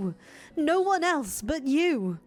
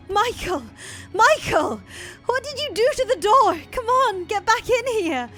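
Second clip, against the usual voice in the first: high, loud and fast desperate yells; frantic screaming; 10 dB louder than usual. Acted. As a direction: scream for him through the door. Giselle pounding at the locked door.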